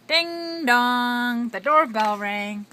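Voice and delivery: dramatic doorbell. A narrator's reading. Reading doorbell moment dramatically.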